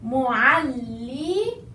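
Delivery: mu'allimun very slow with held ll; slow doubled lam. Shown as a demonstration. Teacher drills the shadda slowly. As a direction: spell out mu'allimun. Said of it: "Just concentrate on the shadda now".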